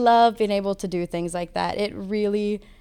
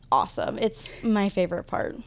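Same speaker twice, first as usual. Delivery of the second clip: ordinary talk, nothing marked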